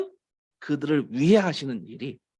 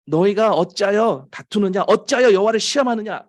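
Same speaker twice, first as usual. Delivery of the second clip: raised, louder preacher's challenge; higher, forceful scolding questions; quoted rebuke; 5 semitones higher, 6 dB louder than usual. Acted. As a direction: demand an answer as Moses rebuking the people. Moses rebuking the quarrelling people.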